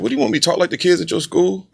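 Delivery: put-on mildly miffed kid talking back to dad; imitating indignant son. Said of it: "he get mad at me".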